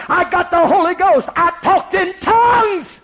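high, loud, triumphant proclamation; joyous shout. Retold or imitated. Wife insisting to the vicar, high joyous shout. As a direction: shout the news for all to hear.

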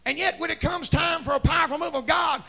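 high, shouted revival preaching; full-force preaching. Real, not acted. Preacher urging church to come down front, shouted exhortation. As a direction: holler it out, driving and insistent.

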